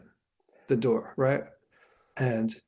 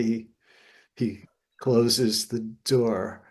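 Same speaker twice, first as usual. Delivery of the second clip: normal talking voice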